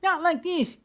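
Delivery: way up high, with a lisp, as a contrast to plain speech; high-pitched lisp. Retold or imitated. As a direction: show what the voice did not sound like, squeaky. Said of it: "clean, boring Midwestern".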